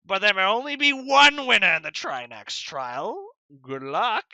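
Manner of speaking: a godlike wizard springing a cruel trap on the winners; theatrical villain announcement, then a mocking farewell; Trinax's twist. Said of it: "and then I say".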